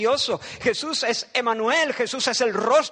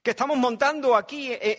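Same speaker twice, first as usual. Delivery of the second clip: usual speaking voice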